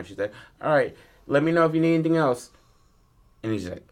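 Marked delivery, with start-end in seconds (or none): 0.6-2.5 high, quick, put-on waitress; pitched-up diner waitress sign-off. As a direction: breeze through it like a busy server. Waitress replies in story.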